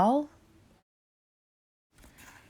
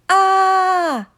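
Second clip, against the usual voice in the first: high very loud shriek; panicked scream; 11 semitones higher, 8 dB louder than usual. A narrator's reading. CJ screams again.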